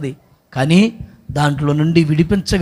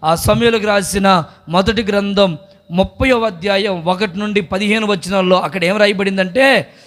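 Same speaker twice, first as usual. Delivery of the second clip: normal talking voice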